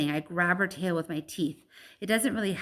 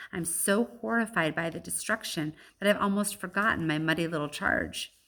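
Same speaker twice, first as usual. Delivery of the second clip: ordinary delivery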